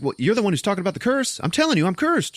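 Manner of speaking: high, rapid-fire indignant retort; worked-up mock protest. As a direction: play someone insisting in an argument. Acted quarrel about the curse.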